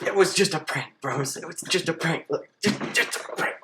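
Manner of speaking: high, loud, hammed-up yelling; frantic prank reveal. Teacher revealing the prank to the class, frantic yelling. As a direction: shout it in a mock frenzy, repeating insistently.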